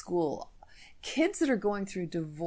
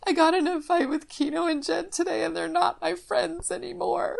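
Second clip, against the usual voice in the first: put-on much higher, teary little-girl voice; sniffling daughter; 13 semitones higher, 6 dB louder than usual. Daughter home from school telling dad about friends, tearful high voice. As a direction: imitate a hurt daughter sniffling out her news. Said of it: "she's sniffling and she says".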